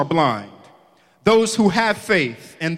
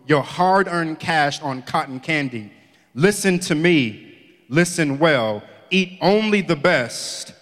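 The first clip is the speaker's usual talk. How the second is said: scripture reading; 5 semitones lower than usual. Acted. Preacher reading scripture to the congregation, measured. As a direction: read the verses out to the church, deliberately.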